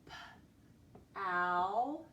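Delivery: "p-ow" as a lone p, a gap, then long ow; sounded out. Shown as a demonstration. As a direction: sound out "p-ow" slowly in two parts. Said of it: "So power would be spelled".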